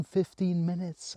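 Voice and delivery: ordinary talk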